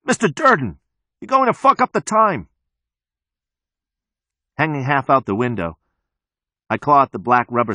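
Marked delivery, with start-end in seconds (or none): 0.0-2.5 high scolding yell. A narrator's reading. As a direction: yell a warning at someone getting away. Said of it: "the Fight Club mechanic yells".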